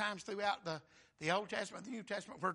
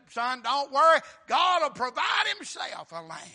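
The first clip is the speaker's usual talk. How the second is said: loud, higher preached cry; preaching shout; 6 semitones higher, 11 dB louder than usual. Acted. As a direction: belt Abraham's promise out. Abraham's words, preached loud.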